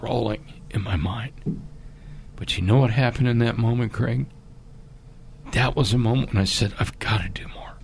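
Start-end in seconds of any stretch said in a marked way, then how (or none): 0.0-1.6 words sink into a soft whisper; hushed whisper trailing off. Real, not acted. Haunting picture replaying in mind. 6.8-7.7 hushed whispered vow; soft unvoiced resolve. Retold moment of private resolve.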